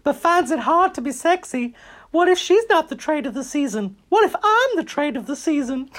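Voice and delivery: very high, squeaky cartoon mouse voice; Minnie Mouse. Host reads out Heidi's funny quote, squeaky mouse voice. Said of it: "She's turning into Minnie Mouse now".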